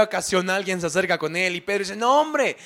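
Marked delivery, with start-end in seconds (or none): 2.0-2.6 higher-pitched, exasperated protest; exasperated denial. Retold or imitated. Peter to a third accuser, exasperated. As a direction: brush them off with a high-pitched denial. Pedro, hostigado, niega por tercera vez.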